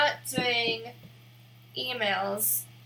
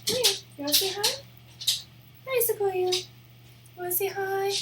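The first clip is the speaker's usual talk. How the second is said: high sing-song pet voice; 9 semitones higher, faster than usual. Real, not acted. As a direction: coo at the dog. Coaxing the dog to greet.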